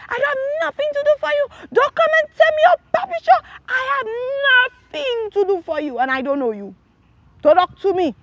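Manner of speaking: high, fast, furious retort; angry outburst. Retold or imitated. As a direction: shout God down in indignation. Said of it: "I get angry this time".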